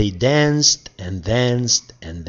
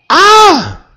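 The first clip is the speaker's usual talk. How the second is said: high, loud scream of fright; startled scream; 10 dB louder than usual. Acted. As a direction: shriek in surprise. Startled by fairy appearing.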